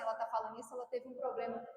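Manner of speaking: low, soft sneering aside; mock a doubting churchgoer, muttered low; mocking critic. Critic in the church mocking the preacher, sneering softly. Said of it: "alguém fala assim".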